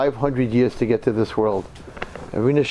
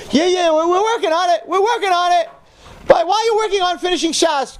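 much higher, louder, sly dismissive brush-off; Satan's voice; 4 dB louder than usual. Acted. The Satan tells the young man to drop his learning, high and sly. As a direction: play the tempter waving the dream aside. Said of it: "the Satan comes and says".

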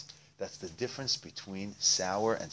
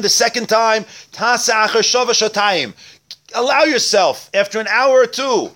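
high, loud, declaimed text teaching; preaching at full force; 12 semitones higher, 12 dB louder than usual. Real, not acted. Rabbi reading the text aloud and expounding it, full force. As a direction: belt out each phrase, far above normal speech.

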